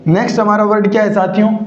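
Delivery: normal talking voice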